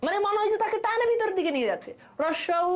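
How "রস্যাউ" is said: রস্যাউ with the tongue pulled back and lips rounded; vowel demo. Shown as a demonstration. Teacher shows back rounded vowels, said clearly. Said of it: "এবার জিহ্বা automatic ভিতরের দিকে".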